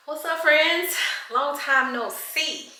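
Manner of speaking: high, slow, sing-song hello; excited greeting. Real, not acted. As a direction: greet friends you missed. Restarted greeting, high and drawn out.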